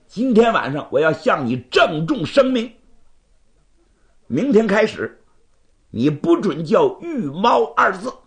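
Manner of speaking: proud rival laying down an ultimatum; indignant challenger. Acted. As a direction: warn him off like an affronted swordsman. Bai issues ultimatum in story.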